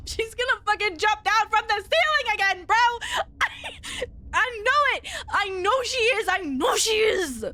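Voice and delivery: panicked excitement. Real, not acted. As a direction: blurt it out in nervous panic. Dreading monster drop in horror game.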